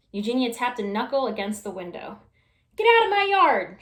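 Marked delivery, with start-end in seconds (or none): 2.8-3.8 high, loud scolding yell; angry shout. Acted. Eugenia shouting at the pig through the window, angry. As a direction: chase the pig off the lawn. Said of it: "she shouted at Mercy".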